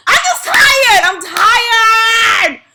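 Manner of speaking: real tearful outburst, high and loud then fading; crying out tired. Real, not acted. Venting to viewers about overdraft fees, crying out loud then fading. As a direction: break down saying how tired you are, easing off. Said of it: "I'm frustrated right now".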